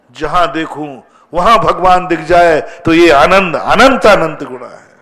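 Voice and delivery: loud, swelling sermon delivery; impassioned preaching. Real, not acted. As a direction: preach it out with force, stretching key words. Preaches at full force. (जोशीला, बुलंद प्रवचन)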